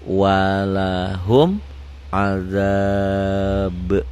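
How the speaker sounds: very slow "Walahum adab", each letter clear; recitation demo. Shown as a demonstration. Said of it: "Tulisannya begini, dibacanya juga begini".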